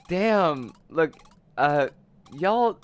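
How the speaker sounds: normal talking voice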